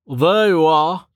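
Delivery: lightly voiced old man; Uman's voice. A narrator's reading. Uman greets Rain warmly.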